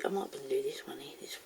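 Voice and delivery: quick unvoiced whisper to herself; whisper to self. Real, not acted. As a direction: breathe it out to yourself while pouring. Whispering to herself while pouring.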